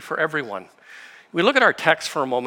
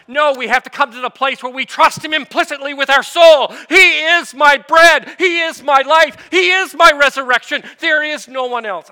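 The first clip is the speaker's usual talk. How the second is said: higher and louder, building to a full shout; excited preaching; 10 semitones higher, 10 dB louder than usual. Real, not acted. Preacher to his congregation about the bread of life, shouting with excitement. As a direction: preach it with mounting fire until it rings out. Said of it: "I'm excited".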